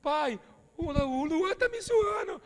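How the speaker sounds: high, slow mock-whine of a crying boy; father mimics his son's crybaby whine; whiny crying child. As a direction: whimper like a teased kid complaining to dad. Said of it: "Eu falo".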